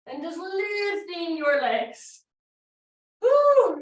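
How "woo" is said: high loud whoop of effort; effortful whoop. Real, not acted. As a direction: let out a strained woo. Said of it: "it's hard".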